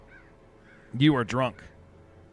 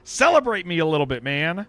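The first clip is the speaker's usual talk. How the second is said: mock complaint; 5 semitones higher, 6 dB louder than usual. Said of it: "You're like".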